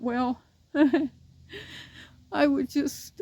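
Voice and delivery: slow, tearful, breaking into a sob; words through tears; crying. Real, not acted. Owner about to tear down her dead puppy's pen, crying slowly.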